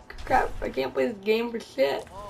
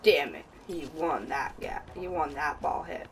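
grumbled under breath; 7 semitones lower, 8 dB quieter than usual. Real, not acted. Grumbling after losing a ball.

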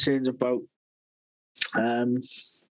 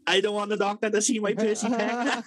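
higher, whining protest; whiny boy; 7 semitones higher than usual. Retold or imitated. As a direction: plead like a fussing teenager. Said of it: "he's like".

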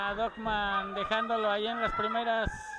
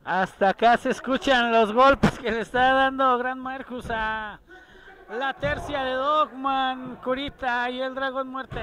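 loud commentary; 3 semitones higher, 8 dB louder than usual. Real, not acted. Live call, hits heard.

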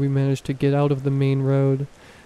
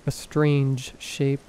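ordinary delivery

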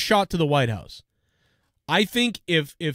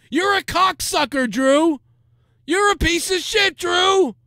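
higher and louder, hammed-up ranting at Drew; mock insult yell; 12 semitones higher, 3 dB louder than usual. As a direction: throw Drew's abuse back at him in a big mock yell. Mocking Drew's insults at guest.

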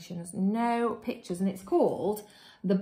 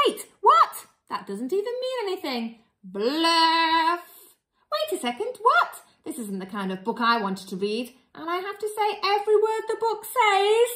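high, hammed-up indignant protest; mock outrage; 8 semitones higher, 5 dB louder than usual. Reader protesting to the children, high mock outrage. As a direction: squeal your disbelief at the book.

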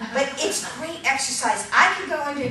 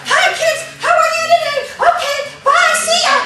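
much higher and louder frantic puppet voice; overly animated puppet; 10 dB louder than usual. Shown as a demonstration. Puppet teacher hamming up an over-animated puppet, high and loud. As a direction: rush it out like a hyper puppet. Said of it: "Your puppet shouldn't be like it's having an epileptic seizure".

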